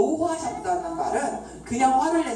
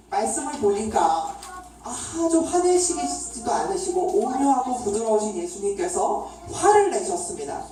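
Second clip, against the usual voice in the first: ordinary delivery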